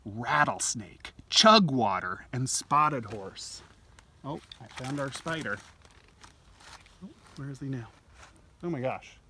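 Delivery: normal talking voice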